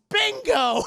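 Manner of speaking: very high shout with laughter; laughing shout. Retold or imitated. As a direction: yell the cat's name. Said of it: "And he goes".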